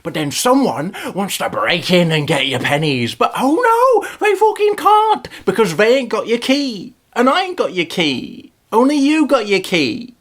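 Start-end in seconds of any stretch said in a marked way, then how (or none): 3.2-5.3 mock triumph. Mocking a thwarted burglar.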